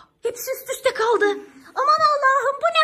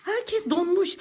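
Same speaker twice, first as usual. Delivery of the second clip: whispering; 4 semitones lower, 5 dB quieter than usual. Acted. Whispering about frozen child.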